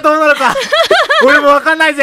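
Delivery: high, gleeful bad-guy boasting; hammy villain voice. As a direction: play the gloating villain with a grin. Hamming up attacking the nose.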